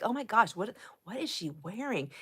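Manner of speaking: put-on a hushed mean-girl aside; catty aside. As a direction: mutter a catty remark about a stranger. Retold catty remark about girl.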